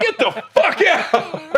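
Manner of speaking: high, drawn-out yell through laughter; laughing imitation of a furious shout; mock angry outburst. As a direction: stretch it high, half laughing. Retelling throwing the fork in anger.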